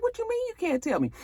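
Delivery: mock incredulous Tamara; incredulous wife. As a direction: push back high and incredulous. Tamara pushing back.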